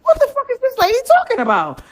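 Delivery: put-on a kid's shocked inner voice going way up; stunned disbelief. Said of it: "in my mind".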